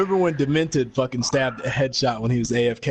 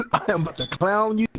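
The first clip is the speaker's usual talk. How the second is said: laughing through the words, pitched up; 5 semitones higher than usual. Real, not acted. Laughing at a rival club, teasing that he'll clown them. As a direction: giggle out the threat to clown them.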